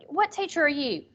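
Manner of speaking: ordinary talk, nothing marked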